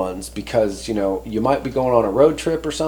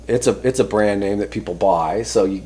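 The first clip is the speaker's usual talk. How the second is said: ordinary talk, nothing marked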